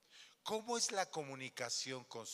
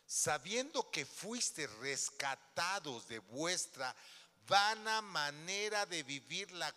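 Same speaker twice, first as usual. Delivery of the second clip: scripture reading; 4 dB louder, slower than usual. Acted. Reads scripture.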